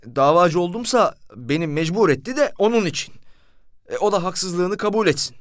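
high-pitched defensive plea; indignant protest. Retold or imitated. Narrator protesting to the commissioner, high indignant plea. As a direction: insist you were forced into it.

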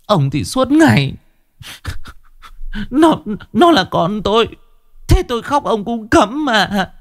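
very high, choked complaining voice; mother choking up, retorting to her husband; tearful wife. A narrator's reading. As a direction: whine back through tears at your husband.

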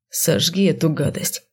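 quiet, squeamish order; disgusted. A narrator's reading. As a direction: spit it out with distaste. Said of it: "брезгливо поморщился он". (брезгливо, с отвращением)

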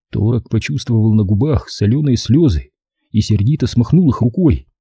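plain reading voice

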